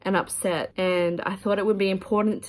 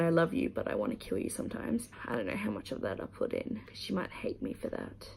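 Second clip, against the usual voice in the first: hushed aside; 9 dB quieter than usual. Real, not acted. Vlogger to her watching sister, hushed aside. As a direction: drop the volume for a private admission.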